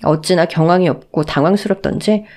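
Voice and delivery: ordinary talk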